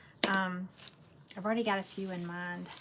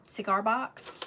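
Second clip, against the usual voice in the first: usual speaking voice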